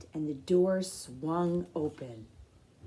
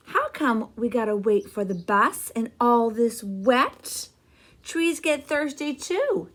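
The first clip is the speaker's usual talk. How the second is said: a hint of a whiny kid, a bit louder; lightly voiced little boy; 4 semitones higher, 8 dB louder than usual. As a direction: complain like a small boy stuck in the rain. CJ complaining in story.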